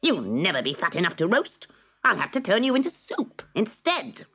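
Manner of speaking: high, cackling witch voice rising at the end; cruel witch. Acted. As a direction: plan the soup with relish. Witch decides on soup.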